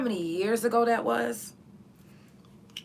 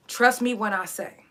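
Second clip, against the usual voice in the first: ordinary talk, nothing marked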